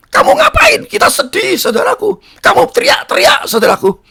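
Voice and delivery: high-pitched, agitated wifely reproach; imitated scolding wife. Wife confronts husband shouting amid mourning, imitated high scolding. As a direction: mimic an upset wife bursting in.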